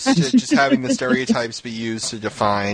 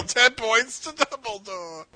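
high, drawn-out comic delivery; musical quote; 9 semitones higher, 4 dB quieter, slower than usual. Retold or imitated. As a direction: stretch it out high, hammed-up. Repeats the musical's best line.

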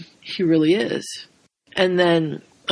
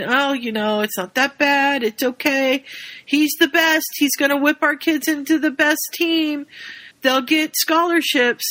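high, fawning dads voice; placating dads; 7 semitones higher than usual. Retold or imitated. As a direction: talk the problem away eagerly. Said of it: "the dads are sitting there going".

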